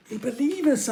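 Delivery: high, breathless surprise; gasping. Acted. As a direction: gasp it out in relief. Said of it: "Akil gasped".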